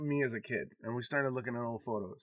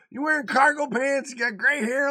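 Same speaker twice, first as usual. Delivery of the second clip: high, loud teasing jab; mock his outfit like a cheeky teen; daughter's voice; 13 semitones higher, 10 dB louder than usual. Dad mimics daughter teasing his cargo pants, high and loud. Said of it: "She goes".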